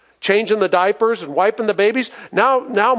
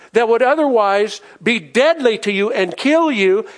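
normal talking voice

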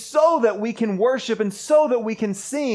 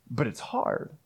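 hushed; 9 semitones lower, 9 dB quieter than usual. Real, not acted. Repeats the point, dropping low.

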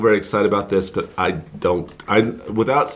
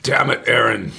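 gruff Bones impression. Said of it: "the Spock and bones of this operation".

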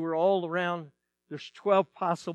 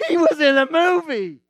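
put-on excited girls; 9 semitones higher, 9 dB louder than usual. Girls jump up and down over the actor, shrieking excitedly. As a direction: imitate kids jumping and shrieking with glee. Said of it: "all the girls start jumping up and down".

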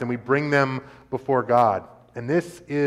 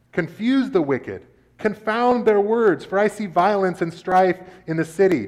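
raised, fervent psalm reading; scripture reading; 3 semitones higher, 3 dB louder than usual. Acted. Preacher reading Psalm fifty-five to the congregation, fervent. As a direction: read the lament like a prayer.

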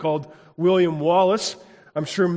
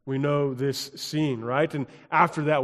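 ordinary talk, nothing marked

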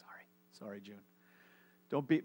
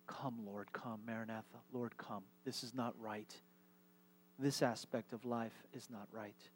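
quiet prayer; 4 semitones lower, 9 dB quieter than usual. Shown as a demonstration. Guided prayer, eyes closed.

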